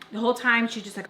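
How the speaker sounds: plain reading voice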